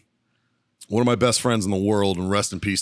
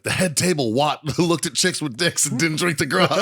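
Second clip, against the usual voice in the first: higher voice breaking into laughter; real laugh bubbling through the words; laughing retelling; 7 semitones higher than usual. Real, not acted. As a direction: crack up while delivering the punchline. Cracking up at own punchline.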